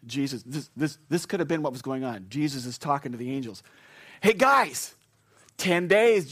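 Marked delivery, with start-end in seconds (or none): 4.2-6.3 high, loud, eager countdown; eager announcement. Acted. Jesus telling the angels Jonathan arrives soon, high and eager. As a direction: announce the news like you can't wait.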